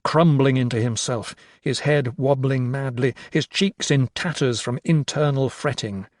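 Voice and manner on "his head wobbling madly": ordinary delivery, nothing marked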